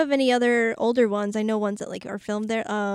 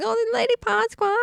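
much higher, drawn-up mock squeal; high-pitched gushing; 11 semitones higher than usual. Host mocks how she keeps saying the hashtag, high-pitched. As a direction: imitate your own high coo. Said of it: "Sorry, I keep going".

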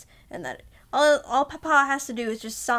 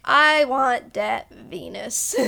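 slow, louder Texan drawl; Texan accent; 5 dB louder, much slower than usual. Acted. Evil oil baron demands the Venus, slow Texan drawl. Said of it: "I don't know why he became Texan".

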